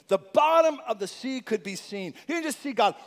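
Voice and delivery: usual speaking voice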